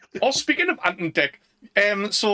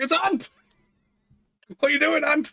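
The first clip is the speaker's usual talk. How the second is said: high, excited call to a character on screen; yelling at the TV in mock surprise; mock shout at the screen; 11 semitones higher than usual. Watching Akira, calling out to the Ant lookalike, high and excited. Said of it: "I was like".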